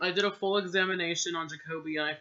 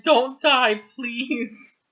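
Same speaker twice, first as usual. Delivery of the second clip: higher, louder mocking cry; mock plea; 7 semitones higher, 9 dB louder, much slower than usual. Reader mocking a crossed-out plea. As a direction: ham up the crossed-out line. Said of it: "It said".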